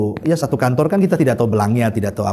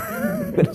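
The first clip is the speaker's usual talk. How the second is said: high squeaky laugh; 6 dB quieter than usual. Real, not acted. After the stoning remark.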